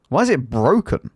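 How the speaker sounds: ordinary talk, nothing marked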